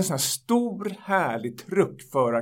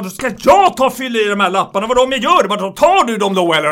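loud, high furious customer voice; 4 semitones higher, 8 dB louder than usual. Retold or imitated. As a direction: shout it like a customer losing it. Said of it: "Han blev helt vansinnig".

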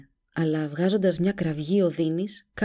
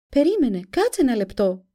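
a hint of a girl begging, raised; pleading; 6 semitones higher, 5 dB louder than usual. A narrator's reading. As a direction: beg her to wait. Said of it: "παρακάλεσε η Ντομίνικα". (παρακλητικά)

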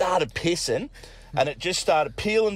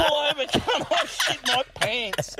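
high, slow, pleading mock panic; desperate plea; 12 semitones higher, slower than usual. Passenger begs his mate to pull over, high slow plea. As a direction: beg him to stop the car now. Narrator begging mate to stop.

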